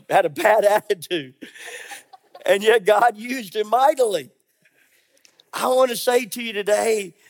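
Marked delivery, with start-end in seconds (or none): 0.1-4.3 laughing. Real, not acted. Laughing at Jonah's attitude.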